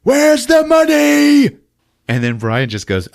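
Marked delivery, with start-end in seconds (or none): 0.0-1.6 high, loud, slow yell; drawn-out shouted threat; mock gang demand. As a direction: holler it like a cartoon thug.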